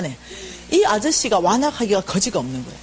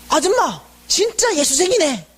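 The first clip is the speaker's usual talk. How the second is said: high, slow exclamation, imitating the vendor; retold shout of praise; 7 semitones higher, slower than usual. Tape seller praising the volunteer, slow high shout. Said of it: "이러는 거예요".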